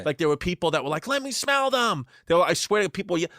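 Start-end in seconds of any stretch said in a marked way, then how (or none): 1.1-2.0 high-pitched mock heckle; imitated creep. Parade creep calling to pageant women, high mock heckle. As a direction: call it out high, like an over-eager creep. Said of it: "the real fucking creeps".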